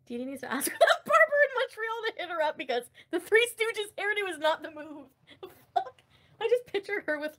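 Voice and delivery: high, loud laugh-crying through the words. Real, not acted. Streamer mocking a hairdo to chat, laughing until she cries. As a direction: wheeze the joke out through tears of laughter.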